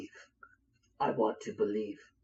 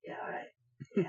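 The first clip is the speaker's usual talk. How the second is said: sulky mumble; 8 dB quieter, much faster than usual. Acted. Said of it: "And Dave says".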